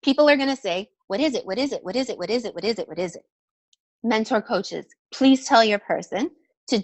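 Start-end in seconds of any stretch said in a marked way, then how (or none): 1.1-3.2 rapid-fire repeated question, tapering off; same swooping tune on every repeat, fading away; mock eager pestering. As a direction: imitate a crowd clamouring to know. Imitates crowd of people asking repeatedly.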